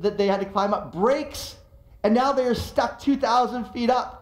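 normal talking voice

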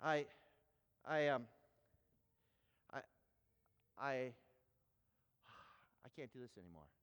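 low, broken starts with long pauses; stammering to a halt. Retold or imitated. Preacher breaks down before the kids, halting and defeated. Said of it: "the stammering has gotten worse, his, his communication more paused".

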